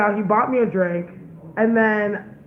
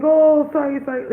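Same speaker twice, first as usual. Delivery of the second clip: higher, louder imitated apology; mock apology; 6 semitones higher, 4 dB louder than usual. Man apologizing to the woman he grabbed, mock apologetic. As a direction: imitate a hasty, overdone sorry.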